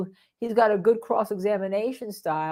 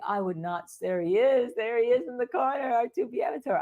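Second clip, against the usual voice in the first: ordinary delivery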